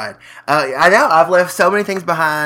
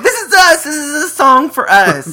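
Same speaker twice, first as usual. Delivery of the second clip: excited; 10 semitones higher than usual. Retold or imitated. Excited about the tour song.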